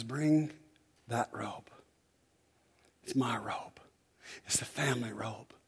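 softly whispered command; hushed father. Acted. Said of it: "The father says".

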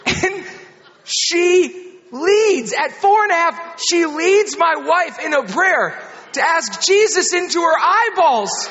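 high, loud, incredulous proclamation; preacher at full comic force; shouted punchline. Real, not acted. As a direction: belt it out over the crowd's laughter, pausing for emphasis.

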